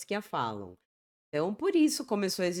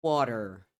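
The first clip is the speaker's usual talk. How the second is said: American "water" with sounded final r; American pronunciation; 6 semitones lower than usual. Shown as a demonstration. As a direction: say water as "WAH-der". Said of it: "Já no inglês americano".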